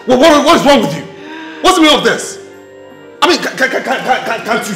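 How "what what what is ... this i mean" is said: loud, high, stammering demand; agitated shouting. Acted. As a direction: berate her in a high raised voice. Man berates intruding lover.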